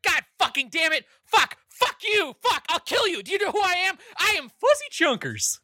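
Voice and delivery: high-pitched screaming rage; a tiny puppet losing it completely; berserk rage. Acted. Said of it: "he's like".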